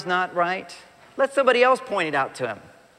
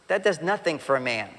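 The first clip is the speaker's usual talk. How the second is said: usual speaking voice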